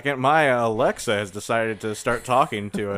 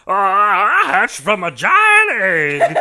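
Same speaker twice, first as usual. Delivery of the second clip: mock hatchling voice; 6 semitones higher, 9 dB louder, much slower than usual. Voicing a hatched Robocorn.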